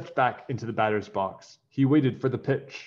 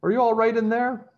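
higher, louder mother calling through a door; 12 semitones higher, 5 dB louder than usual. A narrator's reading. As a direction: call to the bathroom from outside. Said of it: "called his mother".

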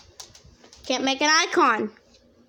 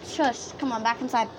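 usual speaking voice

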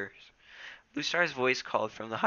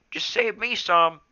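put-on playful young cat; 10 semitones higher, 7 dB louder than usual. A narrator's reading.

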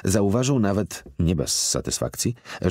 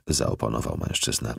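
plain reading voice

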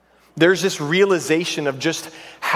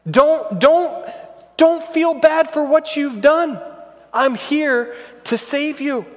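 Joseph pleading; 7 semitones higher than usual. Retold or imitated. Joseph voiced pleading with brothers.

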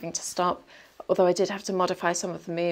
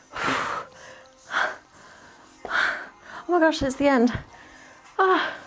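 strained groan; 6 semitones higher, much slower than usual. Real, not acted. Finishing a hard ab workout.

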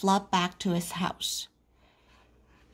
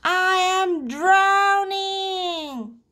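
high, drawn-out wail; crying out; 10 semitones higher, much slower than usual. A narrator's reading. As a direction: wail it slowly for rescue. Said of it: "cried Froggy".

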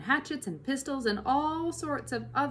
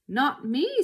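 loud, high protest; screamed; 6 semitones higher, 8 dB louder than usual. Acted. Bunce refusing to go down the hole, a loud high scream. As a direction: yelp it while running off. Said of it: "screamed Bunce".

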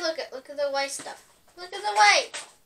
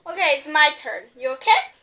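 ordinary delivery

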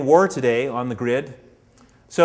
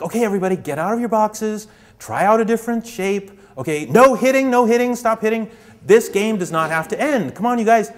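higher, louder call to the group; 8 semitones higher, 5 dB louder than usual. Retold or imitated. Organizer calling to the pawns to stop hitting, loud and high. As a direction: herd them like a camp counsellor. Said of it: "the community organizers are like".

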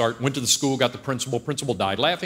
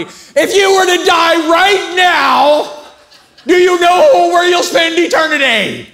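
full-force, very high drawn-out shout; yelling at everybody; 13 dB louder, slower than usual. Acted. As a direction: bellow an urgent altar call through the plane. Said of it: "I yelled at everybody".

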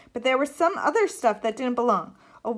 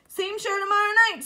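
higher-pitched pitch to a crowd; showman's announcement; 9 semitones higher than usual. A narrator's reading. Sloane to the audience after the show, announcing. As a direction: call it out to the customers.

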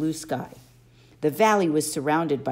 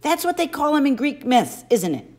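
higher, louder pressing question; demanding; 10 semitones higher, 5 dB louder than usual. A narrator's reading. Boy demands answers from his friend, insistent. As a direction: push him for the truth. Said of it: "I demanded".

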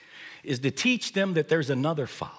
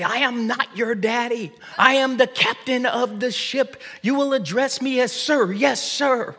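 mock stern captain; 11 semitones higher than usual. Dad playing captain to giggling kids, hammed-up stern command. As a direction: puff up as the pompous captain. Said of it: "And I would say".

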